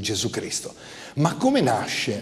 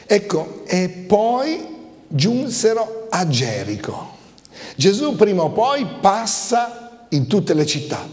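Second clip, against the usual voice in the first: raised-pitch, emphatic sermon delivery; preaching; 4 semitones higher than usual. Real, not acted. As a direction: preach it with building insistence. Preaching with rising force.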